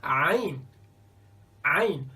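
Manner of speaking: Ain with a tight squeeze in the throat; a strong, throaty Ain. Shown as a demonstration. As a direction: press Ain from the throat, firm and clear. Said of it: "There is no equivalent".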